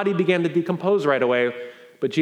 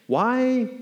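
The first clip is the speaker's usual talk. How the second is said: ordinary delivery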